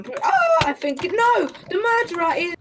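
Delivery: sudden alarm; panicking gamer. Real, not acted. Spots the murderer.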